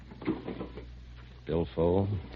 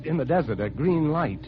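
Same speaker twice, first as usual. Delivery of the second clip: higher, tense and uneasy; growing fear; 8 semitones higher than usual. Acted. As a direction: dread rising as he watches. Eerie green light, on phone.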